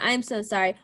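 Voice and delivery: usual speaking voice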